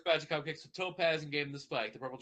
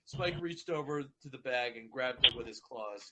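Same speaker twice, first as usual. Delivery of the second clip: ordinary delivery, nothing marked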